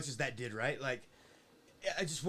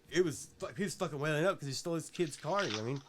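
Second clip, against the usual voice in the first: usual speaking voice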